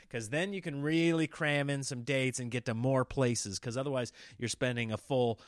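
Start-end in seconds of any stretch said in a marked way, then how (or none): none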